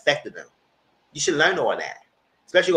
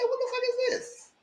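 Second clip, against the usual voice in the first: very high falsetto, slow and drawn; mock shocked wife; 7 dB quieter than usual. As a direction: squeak it out like an appalled woman. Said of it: "She said".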